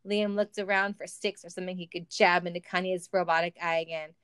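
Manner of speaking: ordinary delivery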